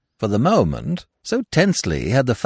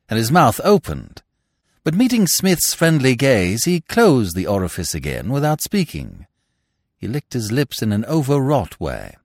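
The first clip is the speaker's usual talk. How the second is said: ordinary delivery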